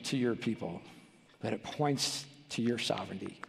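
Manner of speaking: hushed pastoral prayer; closing prayer. Acted.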